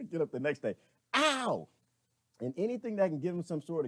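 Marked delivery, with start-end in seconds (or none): 1.1-1.7 high loud mock yelps of pain. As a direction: yelp like you touched a hot stove. Mimicking hand on hot oven.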